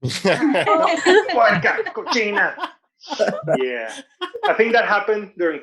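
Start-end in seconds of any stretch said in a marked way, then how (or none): 0.0-2.5 mock insult. Insulting toenail-cutting lady on Zoom.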